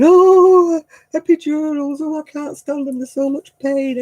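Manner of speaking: high loud whiny moaning; hammed-up whine about needles; mock wailing complainer. Mocking people who dread epidurals, high whiny moaning. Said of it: "everybody's going".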